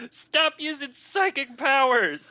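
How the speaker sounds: high, slow yell at the bear; shouting. Acted. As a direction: shout it down from the loft. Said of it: "sort of shouts down from the loft and is like".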